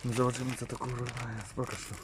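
soft, low-energy mumbling to oneself; barely audible casual aside; too quiet. Real, not acted. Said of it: "Mów głośniej trochę".

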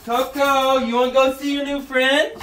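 high singsong voice for a dog. Real, not acted. Owner coaxing Coco to meet her new sister, high baby talk.

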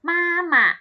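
มาหมะ loud, high long มา, short falling หมะ; model pronunciation. Shown as a demonstration. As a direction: sound out มาหมะ high and clear for learners. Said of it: "อ่านว่า".

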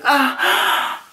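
high, breathy mock gasp; acted startled gasp. Reacting to a grab on the dance floor, high mock gasp.

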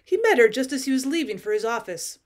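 ordinary delivery

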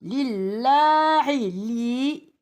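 "Lillahi li" chanted slowly with light lam and long vowels; thin lam recitation. Shown as a demonstration. Teacher showing the esre, "Lillahi li" with thin lam. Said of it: "İnce oluyor".

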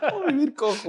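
high, squeaky-pitched words through real laughter; laughing. Real, not acted. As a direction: barely get the joke out while laughing. Laughing at the book joke. (muerto de risa, voz aguda)

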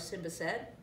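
plain reading voice

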